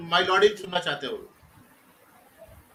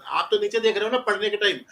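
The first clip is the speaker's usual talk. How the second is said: ordinary talk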